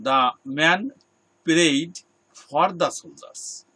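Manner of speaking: slow and loud, "prayed" and "for" each hit hard; emphatic correct model. Shown as a demonstration. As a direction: drive home "prayed for" word by word, loudly. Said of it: "Note it".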